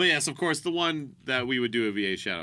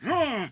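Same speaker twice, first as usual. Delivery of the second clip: put-on excited old man yelp; 6 dB louder than usual. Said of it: "I was like".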